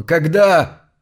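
loud demanding question; требовательно. Acted. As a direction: demand it sharply. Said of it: "потребовал он". (громко, требовательно)

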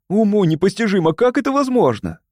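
high-pitched astonished question; boastful amazement. A narrator's reading. Фима amazed by his own excursion, astonished. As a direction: marvel at the impossible. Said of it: "похвастался Фима".

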